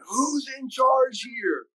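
lightly imitated German accent, high and drawn out; Bonky. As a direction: put a touch of German accent on it, stretched slowly. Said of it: "Bonky in his German accent".

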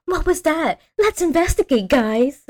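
high-pitched mock-eager voice; eager Daphne. Podcaster mocks Daphne rushing to investigate, high and eager. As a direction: pitch it way up, keen to explore. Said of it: "that person who'd be like".

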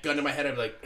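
ordinary talk, nothing marked

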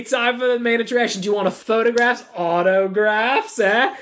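a hint of a preening star offering autographs; mock-grand celebrity voice, lightly done; King Protozoa. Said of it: "And King Protozoa is like".

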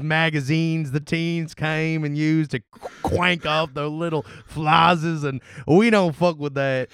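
slow, put-on Southern farm drawl; Southern accent. Farm boy gossiping about an odd brother, Southern accent. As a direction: spin a yarn in a hammed-up Southern accent. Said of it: "start doing a Southern accent".

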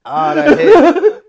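cracking up, high and loud; laughing. Real, not acted. Laughing hard at his friend's jab. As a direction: laugh the words out.

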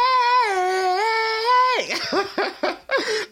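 high squealing laugh at himself; shrieking laugh. Real, not acted. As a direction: burst out laughing, embarrassed. Laughs after own singing.

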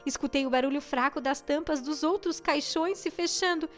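ordinary delivery

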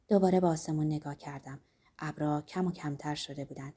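ordinary delivery, nothing marked

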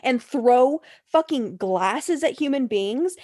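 slow, drawn-out accusation; acted, exasperated telling-off; indignant scolding. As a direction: hammer each charge home slowly, stretching the key words. Acted scolding of absent castmate.

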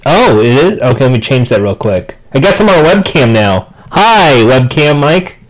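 fuzz distortion on the mic; clipping, overloaded microphone; distorted mic. Real, not acted. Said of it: "Dude, your microphone changed".

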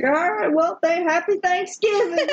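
high, laughing mock cheer; laughing reply. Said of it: "and I'm like".

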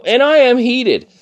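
real worked-up rant, voice jumping high at first; heated. Real, not acted. Worker venting about a maskless customer, heated. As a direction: vent with real heat, leaning on the swearing. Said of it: "I'm fucking heated".

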